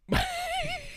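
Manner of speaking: a high, breathy burst of laughter. Real, not acted. Host laughing between shoutouts.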